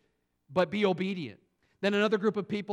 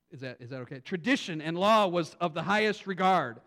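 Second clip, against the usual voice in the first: ordinary talk, nothing marked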